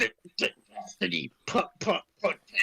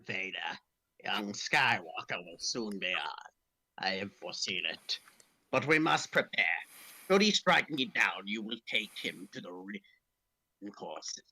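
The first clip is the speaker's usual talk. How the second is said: low, slow sinister emperor; Palpatine hologram; 9 semitones lower, 3 dB quieter than usual. Acted. The Emperor's recording speaks to Vader, low and sinister. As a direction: lower and drag it out like a dying tyrant.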